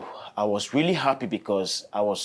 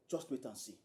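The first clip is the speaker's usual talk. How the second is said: normal talking voice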